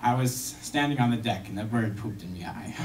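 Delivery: low gruff pirate growl, dropped well down. Acted. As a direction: growl it like an old sea pirate. Pirate voiced in joke.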